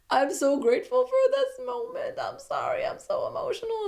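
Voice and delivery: very high tearful squeak; mock emotional. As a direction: imitate a woman overcome with happy tears. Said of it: "She got emotional".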